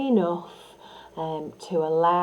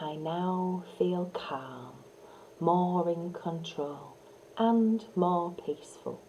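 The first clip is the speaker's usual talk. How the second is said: slow, soft, comforting affirmation; calm soft affirmation; 6 dB quieter than usual. Shown as a demonstration. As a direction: soothe them with each phrase. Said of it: "that voice is soft and warm".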